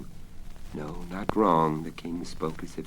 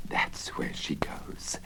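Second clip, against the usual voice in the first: whispering; 5 dB quieter, slower than usual. Acted. Wizard whispers discovery.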